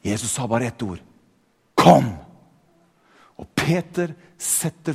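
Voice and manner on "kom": short, loud command; commanding call. Acted. Jesus summons Peter onto the water, commanding call. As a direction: call it out as one firm word.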